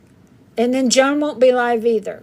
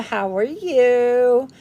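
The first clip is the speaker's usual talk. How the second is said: ordinary talk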